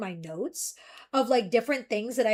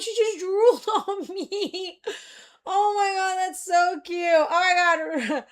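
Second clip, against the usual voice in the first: higher, laughing through the words; laughing delight; 5 semitones higher, slower than usual. Real, not acted. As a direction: giggle as she drools on you. Laughing at cat drool.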